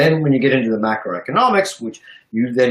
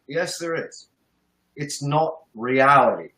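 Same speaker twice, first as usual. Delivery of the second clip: ordinary talk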